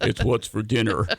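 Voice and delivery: host mocking the beef ad voice; deep ad announcer. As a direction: do the beef commercial tagline. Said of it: "We need the Sam Elliott voice".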